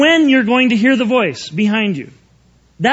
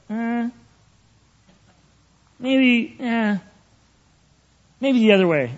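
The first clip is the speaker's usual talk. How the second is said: hesitant, with long pauses; acted doubt; 4 dB quieter than usual. Preacher acts out a question the Lord puts in his mind, hesitantly. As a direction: waver between little doubtful noises. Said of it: "that look on my face".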